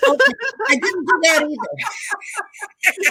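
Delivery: laughing hard through the words. Real, not acted. Guest laughs off her joke with the host, laughing. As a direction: crack up while denying it.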